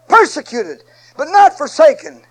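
loud ringing preacher refrain; preaching at full force. Acted. Preacher proclaiming the refrain to his flock, loud. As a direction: hammer it home to the church.

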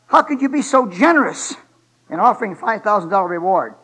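loud mock astonishment; incredulous friend. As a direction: demand an explanation, acting the baffled friend. Acted friend's incredulous challenge to husband.

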